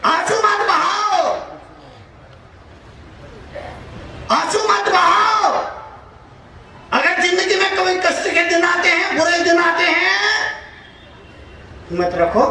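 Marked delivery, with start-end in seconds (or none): 0.1-10.2 impassioned exhortation. Real, not acted. Preacher urging the crowd not to shed tears, exhorting. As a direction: call it out high to the whole crowd.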